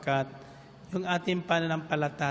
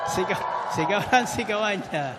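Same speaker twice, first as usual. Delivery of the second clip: mock shouting; 4 semitones higher than usual. Mimicking the woman's constant shouting.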